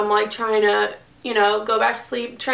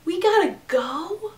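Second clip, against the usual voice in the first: mock panic; 8 semitones higher, 3 dB quieter than usual. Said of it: "And she goes".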